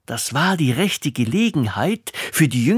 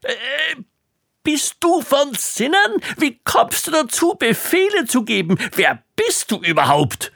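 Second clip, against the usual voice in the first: very high enraged outburst; furious explosion; 11 semitones higher than usual. A narrator's reading. King exploding at the young servant, furious and very high.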